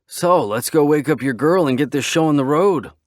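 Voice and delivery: eager. Acted. Eager friend.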